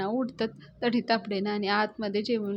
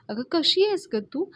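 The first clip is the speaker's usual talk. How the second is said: high, louder exasperated question; 7 semitones higher, 5 dB louder than usual. A narrator's reading. Friend fed up with lovestruck Payal, exasperated. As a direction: scold a friend you cannot believe.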